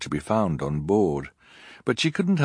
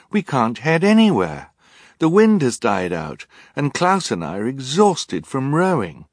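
put-on higher, louder insisting girl; exasperated protest; 7 semitones higher, 6 dB louder than usual. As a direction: push back hard, frustrated. Violet protests loudly.

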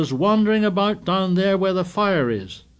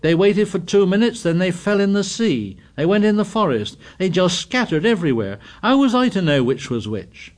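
raised, hurried protest; indignant; 7 semitones higher, 4 dB louder than usual. A narrator's reading. Piggy protesting to Ralph, indignant and raised. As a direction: defend yourself hotly. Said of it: "cried Piggy indignantly".

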